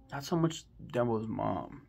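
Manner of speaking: normal talking voice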